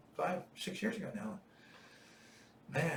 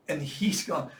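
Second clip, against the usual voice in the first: usual speaking voice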